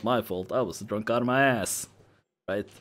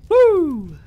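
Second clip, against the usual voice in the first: loud high "woo"; drunken whoop; 12 dB louder than usual. Acted. Drunk friend arriving home, loud high whoop. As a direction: let out a long falling whoop.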